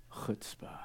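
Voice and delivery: whispered, drawn-out reveal; stage whisper; hushed awe. Acted. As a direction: drop to an awed whisper on the key word. Dramatic reveal of key word.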